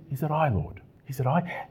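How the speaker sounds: soft, humble asking in the disciples' voice; hushed self-doubting question. Retold or imitated. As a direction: drop the volume and ask as if afraid it's you. Disciples asking Jesus, acted softly.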